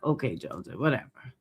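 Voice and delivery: low, quiet brush-off; dismissive. Retold or imitated. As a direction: dismiss them under your breath. Quotes own dismissive reaction.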